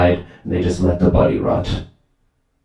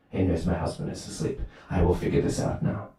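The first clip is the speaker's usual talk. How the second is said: soft, hushed plotting; secretive inner thought; 11 dB quieter than usual. Acted. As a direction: plot it under your breath. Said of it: "the wife, she thinks".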